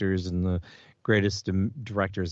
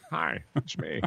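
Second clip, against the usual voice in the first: lightly put-on actor's voice, softer; Patrick Stewart; 3 semitones higher, 6 dB quieter than usual. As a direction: introduce yourself in a mild celebrity impression. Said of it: "it could be Patrick Stewart without the accent".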